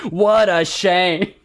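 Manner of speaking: slow, loud, drawn-out sarcasm; heavily sarcastic commiseration. Shown as a demonstration. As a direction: stretch each word with mock pity. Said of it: "there's, like, a sarcasm behind it".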